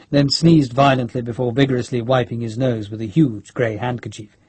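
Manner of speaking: ordinary delivery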